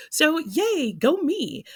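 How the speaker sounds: high, loud little cheer for herself; excited cheer. Real, not acted. Vlogger cheering for herself on finishing her challenge, excited. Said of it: "I'm really excited to be done".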